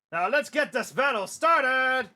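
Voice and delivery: loud, high, drawn-out call to arms; rousing commander's yell; rallying battle cry. Acted. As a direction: launch the battle with a slow, high shout. Comic commander rallying troops.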